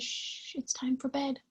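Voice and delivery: soft whispered shush. Acted. Old lady telling the kittens it's bedtime, whispered. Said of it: "She says".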